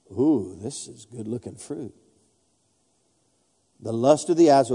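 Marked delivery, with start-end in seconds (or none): 0.1-1.9 tempted Eve. Acted. Voicing Eve tempted by fruit.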